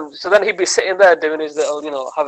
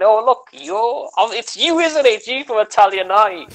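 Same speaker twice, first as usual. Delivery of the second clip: high, slow and delighted, as if spotting a celebrity; imitating the presenter's smug self-regard, high and drawn out; starstruck recognition; 7 semitones higher than usual. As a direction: gush over him like he is famous.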